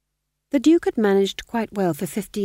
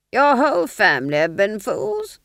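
louder, clipped aristocratic command; cold Duke; 5 semitones higher, 7 dB louder than usual. Acted. Duke dismissing the sergeant: louder cold command. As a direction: dismiss him like a bored lord.

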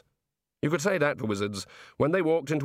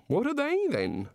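high, airy god voice; a bright chatty god; 13 semitones higher than usual. A narrator's reading.

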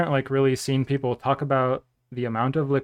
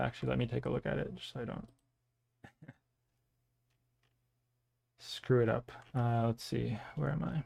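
muttering to himself; 11 dB quieter, faster than usual. Real, not acted. Muttering while checking announcement.

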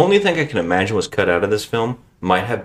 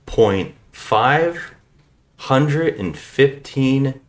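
very slow, drawn-out big number; slow dramatic reveal; 5 semitones higher, much slower than usual. Acted. Host reveals the box office total, slow and grandiose. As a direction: stretch out each word for suspense.

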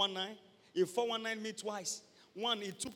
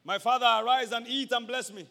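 Esau calling; 4 semitones higher, 9 dB louder than usual. Acted. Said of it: "Esau calls father".

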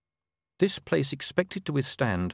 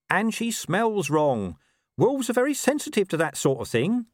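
high-pitched talking dog; Gaspode, explaining; 10 semitones higher, 3 dB louder than usual. Acted.

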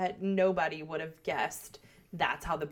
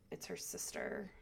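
whispering; 10 dB quieter than usual. Retold or imitated. Conspiratorial reveal of rumour.

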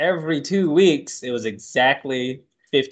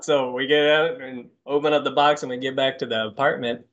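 usual speaking voice

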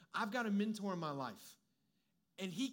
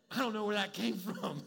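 louder, laughing through the words; 4 dB louder than usual. Real, not acted. Preacher laughing at his own joke to the crowd. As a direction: crack up at your own line.

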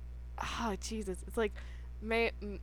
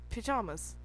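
British accent; 10 dB quieter than usual. Shown as a demonstration. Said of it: "you're British".

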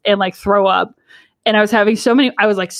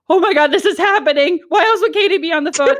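higher-pitched retold freak-out; panicked realization; 8 semitones higher than usual. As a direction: imitate a flustered realization, pitched up. Acted panicked realization at proposal.

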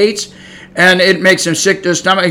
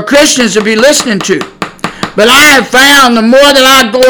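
fiery preaching; 4 semitones higher than usual. Acted. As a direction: thunder the warning at the congregation.

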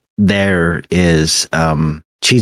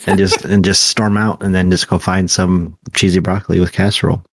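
usual speaking voice

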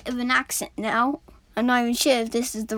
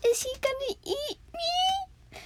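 much higher, slow and timid; scared little voice; 5 dB quieter, slower than usual. Acted. Frightened coal wonders if the boy will eat him. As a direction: ask it like you're afraid of being eaten. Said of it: "Cole's a little bit scared".

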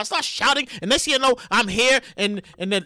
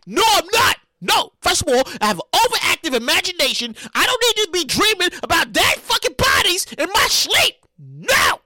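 emphatic refusal; 5 semitones higher, 3 dB louder than usual. Retold or imitated. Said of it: "And I'm like".

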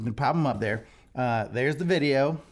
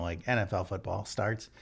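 normal talking voice

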